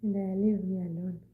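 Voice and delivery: ordinary talk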